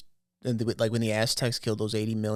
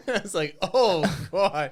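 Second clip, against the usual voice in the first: breaking into high laughter; laughing; 5 semitones higher, much slower than usual. Real, not acted. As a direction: gasp it out while cracking up. Laughing reaction to neck story.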